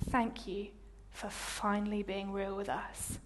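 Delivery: soft, hushed sincerity; tender thanks. Retold or imitated. Team thanking her after her apology, tender and quiet. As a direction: say it gently and quietly, with pauses.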